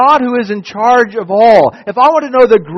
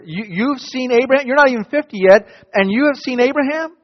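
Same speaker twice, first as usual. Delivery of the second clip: mock disbelief, voice climbing high; high incredulous scoff; 7 semitones higher than usual.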